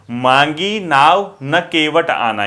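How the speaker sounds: slow, pause after नाव. Shown as a demonstration. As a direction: read मांगी नाव, break, then न केवट आना. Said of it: "ये comma लगा है ना".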